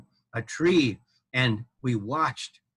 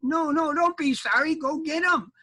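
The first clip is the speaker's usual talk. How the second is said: urgent; 14 semitones higher than usual. Acted. Armadillo mama urging the knight to fetch babies. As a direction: push him to act.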